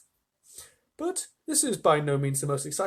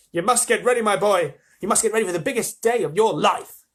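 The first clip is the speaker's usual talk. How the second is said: higher, louder rapid-fire orders; excited old man; 7 semitones higher, 6 dB louder than usual. Acted. Grandpa Joe hurrying Charlie along, high and loud. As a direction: rattle off the list in a fever. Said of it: "shouted Grandpa Joe".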